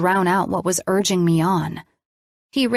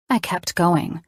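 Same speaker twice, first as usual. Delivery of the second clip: plain reading voice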